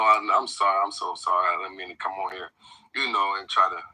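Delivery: usual speaking voice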